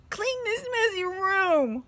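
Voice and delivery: high, slow, crying whine; mother whining in a weepy high voice; whined. Shown as a demonstration. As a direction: whine it like a tearful mom. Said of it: "whined Mother".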